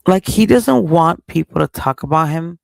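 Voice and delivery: loud, heated complaint; angry loud rant. Real, not acted. Ranting to viewers about Sean, loud and fed up. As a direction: fire it off loudly, fed up.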